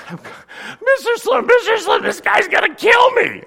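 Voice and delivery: high, loud frantic pleading yell; panicked shout. Retold or imitated. Boy begging the neighbor with the shotgun, loud and high. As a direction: scream for help as if chased.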